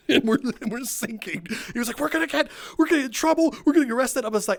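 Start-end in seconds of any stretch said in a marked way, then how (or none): 2.0-4.3 panicking coworker. Retold or imitated. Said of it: "Alex is, he's panicking".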